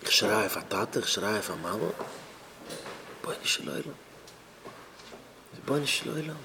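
very soft, low voice; subdued, almost voiceless; hushed appeal. Acted. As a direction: murmur a helpless plea. Acted helpless appeal, very quiet.

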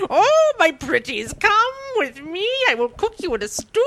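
put-on wicked witch luring children; evil old witch. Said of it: "evil old witch".

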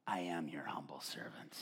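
bow down in a whisper; humble whisper. Retold or imitated. Pastor surrendering to the Lord, low humble whisper.

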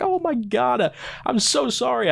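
high, flustered rush of sorry; startled apology. Retold or imitated. Scared visitor apologizes to the actor, high flustered voice. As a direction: sound caught off guard and embarrassed. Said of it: "And I was like".

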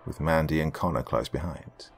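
usual speaking voice

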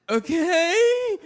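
high, loud voice-cracking okay; cracked squeak. Acted. Dazed narrator answering the women, voice cracking high. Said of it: "Stumbled out of my lust-cracked lips".

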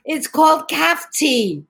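nephew's Liverpudlian accent, lightly imitated; a mild Scouse accent correcting his auntie. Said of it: "with a thick Liverpudlian accent".

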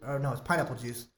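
ordinary talk

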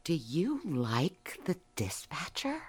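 slow, whispered teasing question; sly whisper. Acted. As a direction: lean in and whisper slyly.

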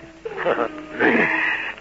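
unvoiced sinister chuckle; breathy villain's chuckle; breathy evil laugh. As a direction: laugh under the breath, gloating. Villain laughs recalling trap.

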